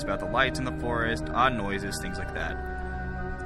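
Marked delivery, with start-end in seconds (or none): 2.0-3.4 hushed creeping horror. A narrator's reading. Eerie forest rumors trailing off.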